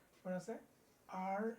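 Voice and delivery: ordinary talk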